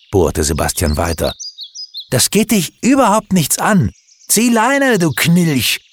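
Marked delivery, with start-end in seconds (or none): 2.1-5.8 very high angry snarl; jealous boy snarling at his friend; hissing angrily. A narrator's reading. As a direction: spit the words out to drive him off. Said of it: "fauchte Stefan".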